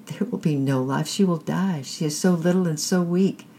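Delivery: plead, voice climbing as it breaks; bitter pleading. A narrator's reading. Mother begging for her blind child, bitterly. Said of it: "cried his mother bitterly".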